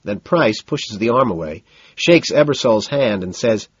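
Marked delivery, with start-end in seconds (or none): none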